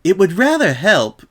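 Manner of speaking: high, measured ladylike voice; careful proposal. A narrator's reading. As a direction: offer it delicately, carefully. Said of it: "Rarity carefully proposed".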